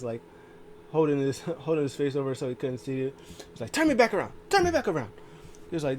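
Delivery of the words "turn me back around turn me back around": loud, very high-pitched order; imitated high yelp of a command; mock frantic yell. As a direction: holler it like a panicked wrestler. Acting out wrestler's frantic orders.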